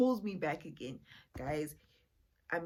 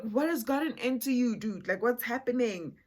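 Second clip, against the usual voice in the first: high, loud retold bewilderment; baffled and pitched up; bewildered protest; 5 semitones higher, 6 dB louder than usual. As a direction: demand an explanation, voice raised. Retold inner reaction to being pushed.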